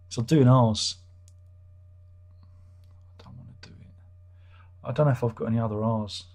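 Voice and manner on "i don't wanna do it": hushed whisper to himself; reluctant whispered grumble. Real, not acted. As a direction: breathe it out under his breath. Grumbling to himself about reviews.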